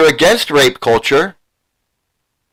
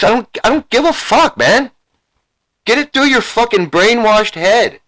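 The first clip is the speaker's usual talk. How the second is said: angry outburst; 6 semitones higher than usual. Real, not acted. Berating the viewer.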